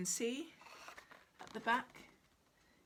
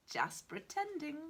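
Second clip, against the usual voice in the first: muttering; 6 semitones higher than usual. Acted. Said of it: "muttered Itchy".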